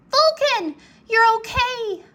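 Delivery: high, loud relieved cry; cried with joy. A narrator's reading. Rory overjoyed that Vulcan is healed, a high loud cry. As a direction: burst out with relief at the dragon. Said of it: "Rory cried".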